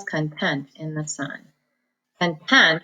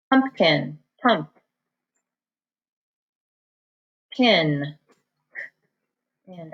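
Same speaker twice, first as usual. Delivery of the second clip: "pumpkin, pump, pin, pin" split into parts with pauses; sounding out syllables; slower than usual. Shown as a demonstration. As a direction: break "pumpkin" into syllables, pausing between them. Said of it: "I use the K for the K sound".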